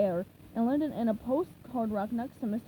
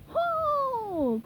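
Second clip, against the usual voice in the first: higher answering ho; cried out; 13 semitones higher, 3 dB louder than usual. A narrator's reading. Teammates answer Krabs's heave, higher cried ho. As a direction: answer the call in a chant. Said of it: "cried the others".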